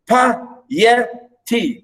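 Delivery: pi... e... ty, carefully set apart; teacher's breakdown of "piety"; syllable by syllable. Shown as a demonstration. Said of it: "stress, unstress, unstress".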